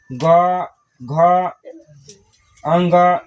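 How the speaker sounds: low, measured chant of the letters, one by one; slow alphabet recitation. Shown as a demonstration. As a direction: recite each letter slowly for the class to follow. Teacher recites the consonant list.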